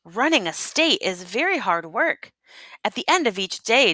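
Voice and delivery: usual speaking voice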